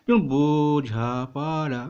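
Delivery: বোঝাপড়া spoken slowly with each syllable stretched; syllables drawn out. Shown as a demonstration. Teacher demonstrating syllables for students, slow and drawn out. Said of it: "জোরে উচ্চারণ করতে পারো".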